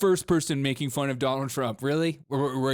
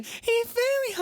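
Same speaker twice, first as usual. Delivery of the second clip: much higher, whiny squeak; mock the host's voice jumping way up; squeaky high voice; slower than usual. Shown as a demonstration. Mimicking the host's voice shooting up when upset, very high. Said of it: "You hear that voice go up?".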